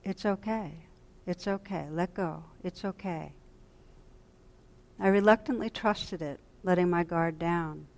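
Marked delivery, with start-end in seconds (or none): 0.0-3.3 soft, soothing, softer with each repeat; calm someone who is afraid to let go; reassuring. Retold or imitated. Wise inner voice soothing a frightened meditator, reassuring. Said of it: "so reassuringly".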